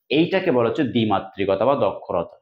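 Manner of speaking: normal talking voice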